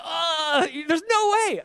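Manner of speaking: high, loud incredulous doubter; mock doubter. Preacher mimics a doubter refusing to believe, high and loud. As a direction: scoff like a skeptic who won't buy it.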